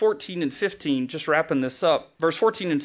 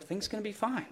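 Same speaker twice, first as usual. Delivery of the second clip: soothing reassurance; 6 semitones higher, 11 dB quieter than usual. Retold or imitated. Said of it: "we just want".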